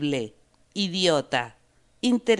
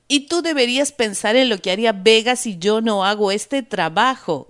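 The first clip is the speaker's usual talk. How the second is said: shouting; 5 semitones higher, 4 dB louder than usual. A narrator's reading. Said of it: "grita Alexander".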